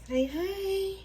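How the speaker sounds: high, sing-song coaxing; pet talk. Real, not acted. Owner shows Alfredo to the viewers, high pet voice.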